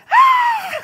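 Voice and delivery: high, loud mock scream; short shriek imitating frightened kids; imitated scream. Kids react to the raincoat dropping, mock scream.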